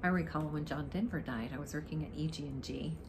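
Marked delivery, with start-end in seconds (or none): none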